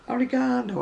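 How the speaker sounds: "oregano" as o-ri-GAH-no, stress on third syllable; British pronunciation of oregano. Shown as a demonstration. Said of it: "I would say".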